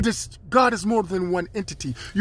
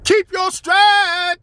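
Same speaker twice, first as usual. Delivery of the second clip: stride stretched slow and high; drawn-out high call; 12 semitones higher, 6 dB louder, much slower than usual. Acted. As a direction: sing out stride slowly, way up. Preaching a mantra.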